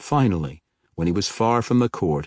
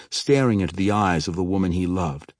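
usual speaking voice

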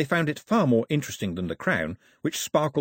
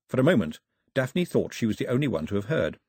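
ordinary delivery

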